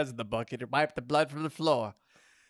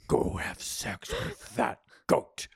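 goddess order; slower than usual. A narrator's reading. Goddess orders him in strained voice.

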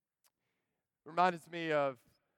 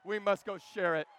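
ordinary talk, nothing marked

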